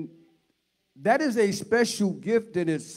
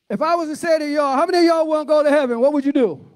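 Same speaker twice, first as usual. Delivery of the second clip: high, loud call to the crowd; rallying shout; 8 semitones higher, 8 dB louder, faster than usual. Real, not acted. Preacher asks congregation who wants heaven, rallying shout. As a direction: fire up the congregation with a raised voice.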